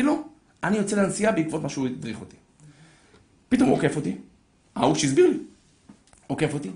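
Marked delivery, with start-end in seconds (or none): none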